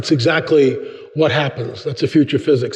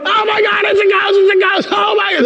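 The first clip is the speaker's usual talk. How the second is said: panicked shriek. Acted. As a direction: shriek like terrified disciples. Disciples panicking, very high shout.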